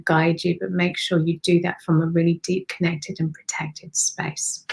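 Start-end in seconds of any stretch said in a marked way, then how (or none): 2.7-4.6 slow, softer, unvoiced words; hushed, slowed ending; slow whisper. Real, not acted. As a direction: breathe it out as a whisper. Closing a calm instruction.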